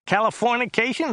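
high, nasal whine; Joe's whiny voice. A narrator's reading. Driver showing off a word to his passenger, high and whiny. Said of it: "His voice was rather high and whiny".

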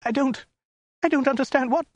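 very high falsetto woman, frightened; squeaky, panicked confusion; bewildered wail. A narrator's reading. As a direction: wail helplessly, high and halting. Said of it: "she wailed".

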